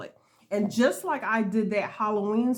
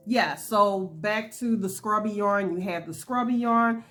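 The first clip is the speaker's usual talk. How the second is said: ordinary talk, nothing marked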